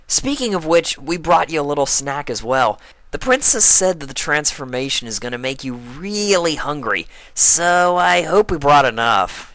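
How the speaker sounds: brash and teasing; Rainbow Dash voice. Acted.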